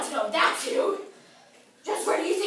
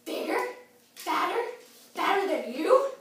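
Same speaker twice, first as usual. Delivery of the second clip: loud troll questions, one by one; intrigued questioning; 10 dB louder than usual. Acted. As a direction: separate each question, rising with growing interest. Troll reacts to tempting offer.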